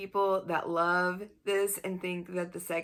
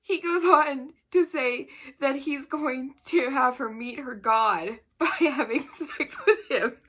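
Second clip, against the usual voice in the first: higher, louder, giggles breaking through; laughing while telling; 6 semitones higher, 5 dB louder than usual. Real, not acted. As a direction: laugh your way through the sentence. Laughing at cringe book passage.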